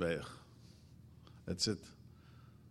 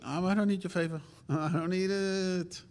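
higher, put-upon and huffy, with a dry laugh; begrudging; 7 semitones higher than usual. Retold or imitated. Mocking a grudging helper, sulky and put-upon. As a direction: grumble the favor away. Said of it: "does it begrudging".

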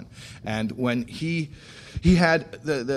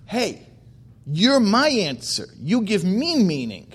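higher, drawn-out earnest call; voicing God; 8 semitones higher, 3 dB louder than usual. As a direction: call out to someone with delighted insistence. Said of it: "revelation is God saying".

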